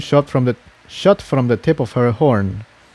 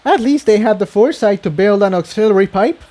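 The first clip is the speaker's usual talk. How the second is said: lightly voiced Tiptoe; 8 semitones higher, faster than usual. A narrator's reading. Tiptoe's voice in story.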